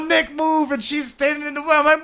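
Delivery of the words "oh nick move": raised, urgent pitch; retold frantic plea. Husband retelling rushing past his wife with a diaper, frantic plea. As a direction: re-enact urging someone out of the way.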